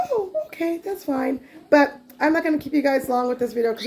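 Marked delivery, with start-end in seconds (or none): 0.1-1.4 lightly voiced little girl giving in, softer; a hint of a placated child; imitated appeased child. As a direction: imitate a child accepting it, starting high and dropping. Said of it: "She was like".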